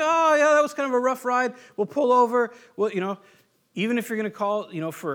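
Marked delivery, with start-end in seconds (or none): 0.0-2.5 mock casual boater. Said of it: "You don't say".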